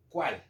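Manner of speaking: "cuál" stressed alone, falling at the end; emphatic question word. Shown as a demonstration. Teacher showing why the question word takes an accent, stressed "cuál". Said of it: "para hacer énfasis, es decir".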